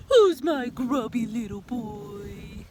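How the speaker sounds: slow, cooing mother voice; slow affectionate baby talk; doting mom. Acted. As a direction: coo it like a fond mom.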